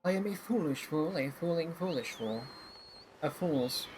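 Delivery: ordinary talk, nothing marked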